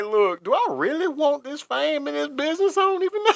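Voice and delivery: high, put-on voice of a doubting man; imagined doubting Gusher. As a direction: voice his second thoughts, half joking. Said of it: "He was like".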